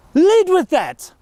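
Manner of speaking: "Lead" hit hard and drawn out, slowly; emphatic point. Shown as a demonstration. A coach drilling the key lesson to students, slow and emphatic. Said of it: "Start with that message".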